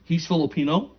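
ordinary talk, nothing marked

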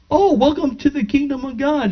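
high-pitched gushing welcome; joyful church lady. Retold or imitated. Lady welcoming the newly filled backstage, retold joyfully.

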